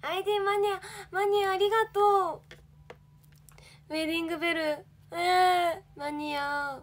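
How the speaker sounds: high, loud delighted thanks; thrilled idol thanking fans; excited squeal. Real, not acted. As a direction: squeal with joy at the fans' messages.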